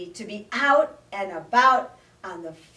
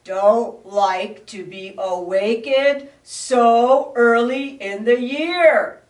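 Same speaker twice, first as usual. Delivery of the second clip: growling bear; 3 semitones higher, 8 dB louder than usual. Acted. Grumpy bear scolds the little frog, growling. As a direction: grumble it, big and cross. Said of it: "he growled".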